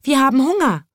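high little bird voices; hungry birds. A narrator's reading. Birds asking the chimney sweep for food, high and chirpy. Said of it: "riefen die Vögel".

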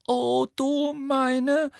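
high, slow, stunned gasp; drawn-out breath of horror. A narrator's reading. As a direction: gasp it out in shock. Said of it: "keuchte Hermine".

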